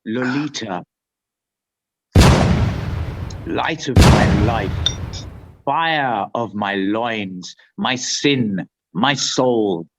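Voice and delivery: low, hushed literary recital; dramatic reading. Acted. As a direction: read it like a brooding confession. Reads Lolita aloud, dramatic.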